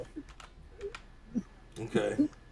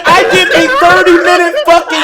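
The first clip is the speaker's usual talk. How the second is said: laughing; 8 semitones higher, 4 dB louder than usual. Real, not acted. Protesting while laughing.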